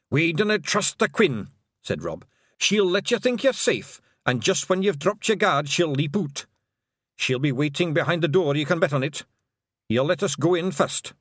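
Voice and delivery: high, brisk Scottish wee-man voice; cocky Scots Feegle; Scottish pixie. Acted. Rob Anybody warns.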